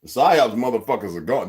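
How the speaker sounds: ordinary delivery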